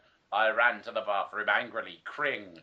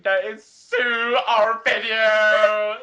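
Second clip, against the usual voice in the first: loud, high mock sob; hammed-up cry; 10 semitones higher, 9 dB louder, slower than usual. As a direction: overdo the heroine's anguish. Melodramatic reading of fanfic line.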